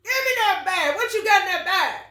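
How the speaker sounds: high, loud demanding shout through laughter; mock demanding. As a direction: demand the bag like a pushy cop. Acted demanding quote, laughing.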